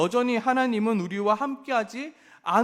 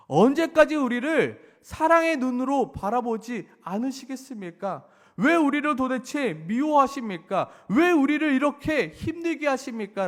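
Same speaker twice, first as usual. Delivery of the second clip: higher, louder anguished why; pleading complaint; 6 semitones higher, 5 dB louder than usual. Acted. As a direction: press God with a desperate why. Voicing the people's complaint to God. (높고 크게 하소연하듯)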